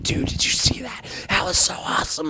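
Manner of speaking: high unvoiced whisper, kid at a sleepover; hushed excitement past bedtime; excited whisper. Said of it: "you're just like".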